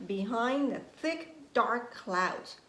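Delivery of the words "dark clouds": plain reading voice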